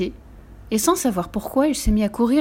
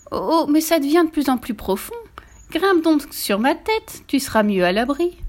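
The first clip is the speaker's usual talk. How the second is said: higher, coaxing fake concern; sly voice; 7 semitones higher than usual. A narrator's reading. Fox lures the gingerbread man onto its head, slyly. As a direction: lure them with sly, raised-pitch concern. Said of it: "d'une voix rusée".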